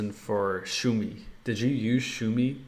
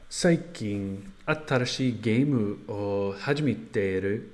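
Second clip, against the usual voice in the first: slow careful learner Japanese; halting Japanese from an English speaker; japanese learner accent. Real, not acted. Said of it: "I'll try that".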